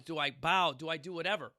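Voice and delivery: normal talking voice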